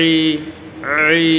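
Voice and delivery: 'ই' squeezed out of the throat; pressed throat Ain. Shown as a demonstration. Teacher isolates the Ain sound, 'ই' pressed from the throat. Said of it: "হলকের মধ্যে pressure দিতে হবে".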